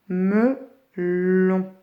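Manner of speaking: Melon as me, pause, llon; split into syllables. Shown as a demonstration. Teacher letting pupils hunt for L, Melon in slow pieces. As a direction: hold each syllable of "Melon" apart. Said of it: "le nombre de syllabes de melon".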